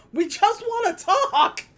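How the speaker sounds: high, slow, laughing fake-friendly plea; mock sweet villain. Burglars coaxing the trapped kid, high mock plea. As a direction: coax the kid out with a laughing sing-song. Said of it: "they were just like".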